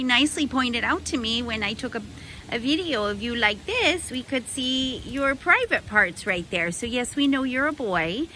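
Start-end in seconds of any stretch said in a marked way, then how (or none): none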